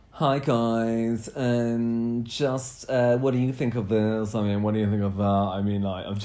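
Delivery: low, droning Eeyore-like delivery; dry impression of co-host. Host does an audio puppet of co-host, dry. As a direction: imitate your co-host as drily as possible. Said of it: "You're quite dry though".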